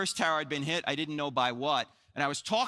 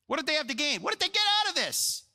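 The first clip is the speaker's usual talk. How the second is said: high, insistent rhetorical questioning; incredulous challenge; 10 semitones higher, faster than usual. Real, not acted. Speaker asking what New Testament writers gained, high incredulous challenge. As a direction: press the question, pitch soaring in disbelief.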